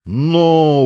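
high loud menacing shout; threatening cry. A narrator's reading. Sergeant threatening Grigory with a loud cry. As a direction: bark the warning out. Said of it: "угрожающе вскрикнул урядник".

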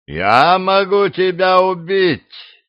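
slow, high threatening demon; menacing threat. Acted. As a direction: threaten him slowly.

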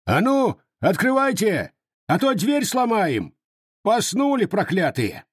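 high-pitched demanding yell; booming shout. A narrator's reading. Cossack breaking at the door at night, shouting to open up. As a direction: shout angrily to be let in. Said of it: "зычно кричал".

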